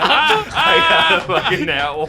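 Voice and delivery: high, loud cracking wail through laughter. Real, not acted. Player after a disastrous roll, real voice crack. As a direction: wail it slowly till the voice cracks. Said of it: "[name]'s voice cracks".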